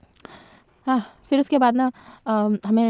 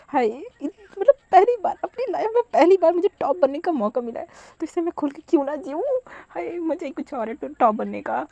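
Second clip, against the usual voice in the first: giddy excitement; 7 semitones higher than usual. Acted. Wing thrilled at first chance.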